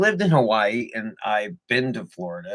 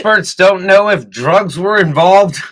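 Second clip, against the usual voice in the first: news anchor voice; 8 semitones higher, 13 dB louder than usual. Pretend. Mock news report line.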